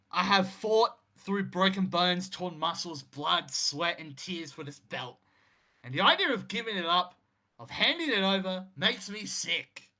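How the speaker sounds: a touch of shaky anger, loud at the start; lightly acted defiant champion, voice wavering; trembling defiant anger. Injured champion refusing to give up his belt, mildly angry and trembling. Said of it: "His voice starts trembling with both anger and defiance".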